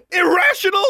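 very high, loud hysterical shriek; screamed. A narrator's reading. Daughter throwing her father's word back, screaming. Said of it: "she screamed".

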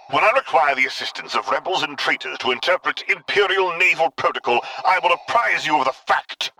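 icy dark lord rebuke; Vader. A narrator's reading. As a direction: cut down the rebel son. Vader voice held by narrator.

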